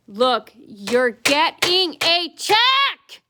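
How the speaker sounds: high, loud, very slow mocking whine; mocking whiny clerk. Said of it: "She goes".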